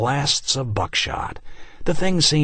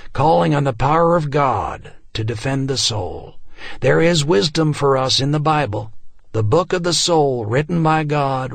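preaching; 4 semitones higher than usual. A narrator's reading. Preacher addressing the congregation, raised sermon voice. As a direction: preach to the frightened flock.

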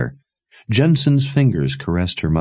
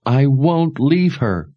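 tearful refusal; 10 semitones higher, slower than usual. Acted. Daughter refuses to leave.

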